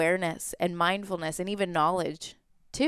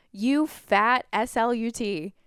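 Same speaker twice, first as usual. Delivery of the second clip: retold scream; 4 semitones higher, slower than usual. Girl screaming from car window at her, mild mock yell. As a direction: imitate a drawn-out insult scream at held-back volume. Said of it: "screamed out the window".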